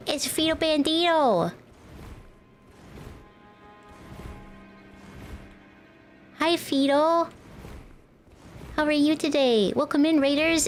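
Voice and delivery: bright high-pitched greeting voice; cute anime streamer. Acted. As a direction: squeal hello to the new arrival.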